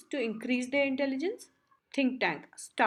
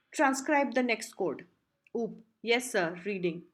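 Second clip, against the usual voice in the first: plain reading voice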